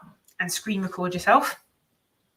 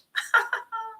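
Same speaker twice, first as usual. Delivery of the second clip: laugh. Real, not acted. Reacting to a daunting challenge.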